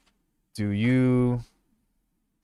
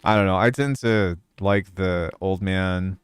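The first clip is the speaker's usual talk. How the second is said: ordinary talk, nothing marked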